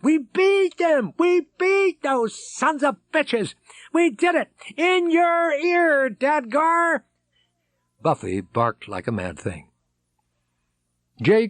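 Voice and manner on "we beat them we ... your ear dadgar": very high, slow victory shout; triumphant yell. Acted. Someone yells in triumph at the reunion. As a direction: crow over the beaten enemy. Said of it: "Somebody yelled".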